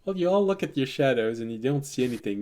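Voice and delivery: normal talking voice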